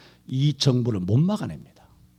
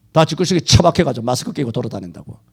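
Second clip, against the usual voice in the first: ordinary talk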